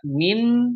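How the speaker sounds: "min" ending on a clear N; clear izhar. Shown as a demonstration. Teacher showing izhar, "min" with clear final N. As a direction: say "min" with a distinct, unblurred N. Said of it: "itu artinya izhar".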